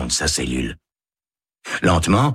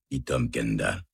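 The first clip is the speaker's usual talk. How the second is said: ordinary delivery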